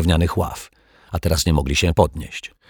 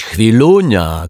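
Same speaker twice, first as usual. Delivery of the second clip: loud, drawn-out higher call; shouted; 5 semitones higher, 7 dB louder, slower than usual. A narrator's reading. Rogue stops the detective grabbing the wallet, shouting. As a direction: call out to halt them. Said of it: "krzyknął".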